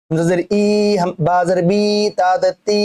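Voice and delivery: ই, বি, তি held long; wrong demo with long "ee" on ই, বি, তি; wrongly stretched vowels. Shown as a demonstration. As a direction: drag out the endings ই, বি, তি as a mistake. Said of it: "এরম না".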